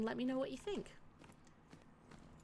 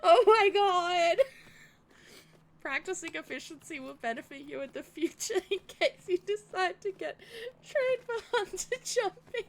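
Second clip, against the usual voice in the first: laughing cry; 7 semitones higher, 8 dB louder than usual. Real, not acted. Gamer laugh-cries while the trainer lectures her. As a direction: whimper through laughter.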